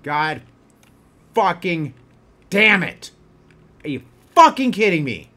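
a rage that swells word by word; angry outburst. Real, not acted. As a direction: snap in real fury. Rage building again.

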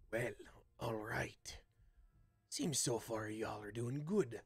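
lightly voiced gruff band leader giving orders; Voltar. Game master voices Voltar.

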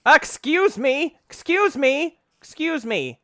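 high, loud repeated protest; indignant yell. Real, not acted. Player shouting at an attacker in game, indignant and high. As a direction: cry out as if under sudden attack.

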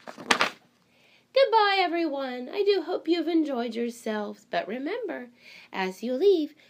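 storybook librarian welcoming animals; fussy librarian. Acted. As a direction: play the flustered, delighted librarian. Librarian voice continues.

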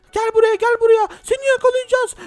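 high, loud threatening call; chasing bully. Pretend. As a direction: shout after him as you chase. Bullies chase him.

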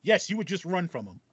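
normal talking voice

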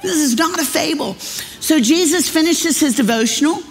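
ordinary delivery

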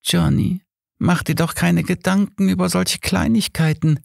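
high, longing woman's voice; ghostly lover. Acted. Dead Mary to Johnny, high and yearning. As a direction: plead sweetly as a dead lover.

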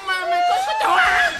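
very high-pitched scream of disbelief; hammed-up piercing outcry; shocked shriek. Shocked reaction to pregnancy news.

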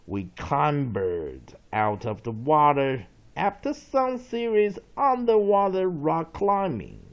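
loud, slow dictionary reading; read-along definition. Shown as a demonstration. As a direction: read it out emphatically for students to follow. Reads example sentence aloud. (大声慢速朗读释义)

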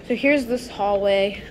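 ordinary delivery

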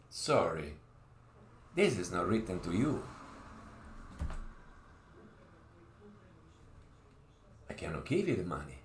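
calmly turning the claimant down; mock lawyer. Lawyer voiced in retold story.

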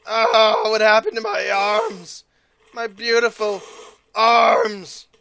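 hammed-up loud wail, drawn out; mock wailing over arms. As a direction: wail in fake grief for lost arms. Character loses arms, wailing.

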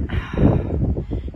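long, breathy put-upon sigh; acted exasperated exhale; heavy sigh. A narrator's reading. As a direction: sigh like a weary child. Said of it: "looked up from his bucket and sighed".

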